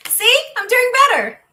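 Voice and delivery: high, loud laughing boast; giggly high brag. Real, not acted. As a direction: squeal it through a laugh. Laughing at herself mid-recording.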